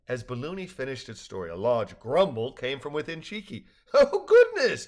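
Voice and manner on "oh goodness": high, loud amused exclamation; laughing. A narrator's reading. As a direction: exclaim through a laugh, pitched way up. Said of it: "Gerald said with a laugh".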